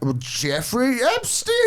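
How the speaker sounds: put-on slow, high old-lady voice; falsetto lady answer. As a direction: drawl it in a falsetto. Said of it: "he was like".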